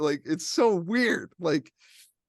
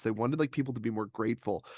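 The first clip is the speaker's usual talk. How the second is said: ordinary delivery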